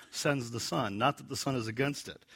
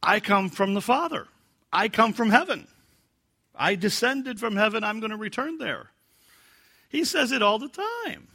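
high, loud and bright with enthusiasm; eager preacher voice; excited voice; 10 semitones higher, 4 dB louder than usual. Shown as a demonstration. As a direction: proclaim it with excited energy. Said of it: "That's my excited voice".

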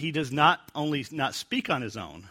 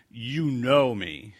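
slow knowing statement; 4 dB louder, much slower than usual. Shown as a demonstration. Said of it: "if it's straightforward".

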